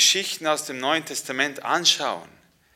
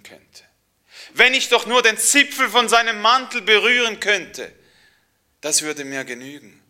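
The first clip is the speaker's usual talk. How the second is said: desperate longing; 8 semitones higher, 10 dB louder than usual. Acted. Sick woman longing for Jesus.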